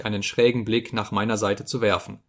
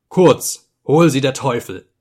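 louder, exasperated dismissal; angry outburst; 2 semitones higher, 7 dB louder, slower than usual. A narrator's reading. Drunken narrator inwardly cursing the company, angry outburst. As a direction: curse them off with sudden force.

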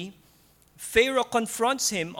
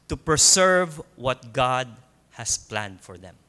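slow, deliberate phrases with pauses between; 3 semitones lower than usual. Real, not acted. Preacher making sure the congregation gets the key point, very slowly. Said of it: "I'll say this very slowly so you will get it".

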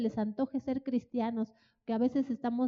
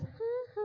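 high sneering laugh; a short taunting cackle; mocking laugh; 11 semitones higher, 7 dB quieter than usual. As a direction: laugh at them in scorn. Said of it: "llegaron a hacerles".

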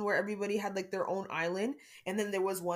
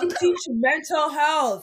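loud, slow laughing outburst; laughing disbelief; 6 semitones higher, 11 dB louder, slower than usual. Real, not acted. Mocking the teacher, laughing.

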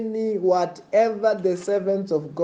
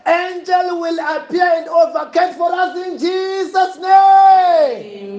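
fiery preaching; 10 semitones higher, 6 dB louder than usual. Acted. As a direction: roar the blessing over the crowd.

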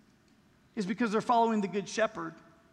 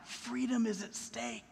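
grave hushed warning; 9 dB quieter, slower than usual. Real, not acted. Sermon's grave climax line.

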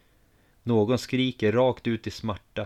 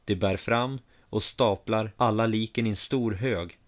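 ordinary delivery, nothing marked

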